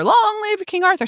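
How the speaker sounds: high chanting crowd voice; cheering crowd. Retold or imitated. Crowd outside the door hailing the new king, high chant.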